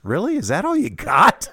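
high, incredulous scoff; a kid silently mocking his dad's mild curse; mocking inner voice. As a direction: tease the dad's weak insult. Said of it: "I thought".

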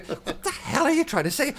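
high-pitched mock bafflement, laughing; laughing, incredulous self-quote; baffled exasperation. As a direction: act out being utterly stumped, voice leaping up. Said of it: "some of it I was struggling with. Going".

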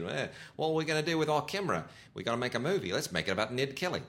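light put-on Australian accent, quick; a jokey Aussie accent. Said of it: "I'm not commenting on your Australian accent".

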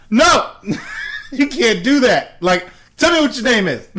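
loud, high outburst with laughter; mock outrage. Agent refusing a caller's fake name Bob, mock outraged. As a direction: object loudly, half laughing.

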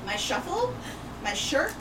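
normal talking voice

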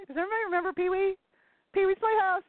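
put-on high, fast squealing man-child voice; Pee Wee Herman. Host imitating a kids' TV star for the chat, squealing high and fast. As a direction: shriek it like a giddy kid-show host. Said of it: "Pee Wee Herman scream".

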